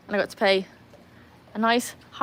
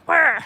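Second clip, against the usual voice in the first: high, loud mock whirr; imitated animal screech; 9 semitones higher, 8 dB louder than usual. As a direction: burst out with a sudden creature noise. Imitating an animal's sudden attack.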